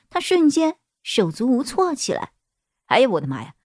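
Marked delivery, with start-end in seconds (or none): 2.9-3.5 low flustered male voice; flustered man. Acted. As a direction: panic like a helpless man. Boss panics at her crying.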